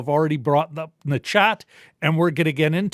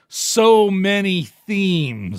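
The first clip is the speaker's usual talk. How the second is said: drawn out; 4 dB louder, much slower than usual. Real, not acted. Drawn-out, overwhelmed about the themes.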